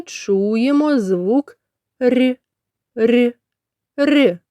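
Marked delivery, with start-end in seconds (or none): 2.0-4.4 soft "р" repeated with short gaps; soft consonant. Shown as a demonstration. Teacher isolating the middle sound, soft "р". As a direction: palatalize each "р", one at a time. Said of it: "Він вимовляється м'яко".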